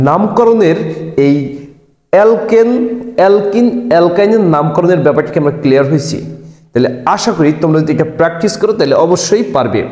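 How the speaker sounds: normal talking voice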